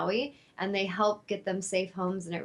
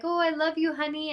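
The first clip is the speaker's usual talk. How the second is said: high, doting coo; retold sweet baby talk; affectionate pet talk; 7 semitones higher than usual. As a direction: coo to a dog in a raised, loving voice. Replays pet talk to dog.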